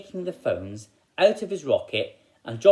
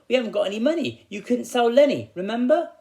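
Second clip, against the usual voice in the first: put-on high, louder cheeky child; cheeky boy; 12 semitones higher, 6 dB louder than usual. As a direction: remind dad as if he's silly. Hansel reminds father cheekily.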